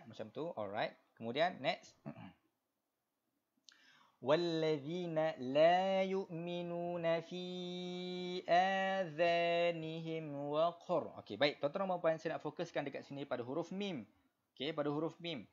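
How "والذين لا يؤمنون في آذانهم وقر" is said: slow, higher melodic tajwid chant; Quran recitation. Shown as a demonstration. Teacher reciting verse for the class, slow melodic tajwid. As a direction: recite for students to follow.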